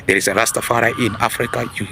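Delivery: normal talking voice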